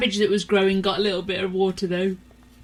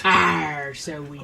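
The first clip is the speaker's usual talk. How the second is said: pirate growl; 5 semitones lower than usual. Acted. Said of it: "we've got to do pirate talk".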